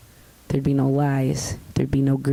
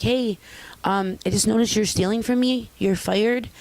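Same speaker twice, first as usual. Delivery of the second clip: higher, mild and polite boss; polite firing; 6 semitones higher than usual. Shown as a demonstration. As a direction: fire him gently, no curse words. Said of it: "It's a difference, an inflection".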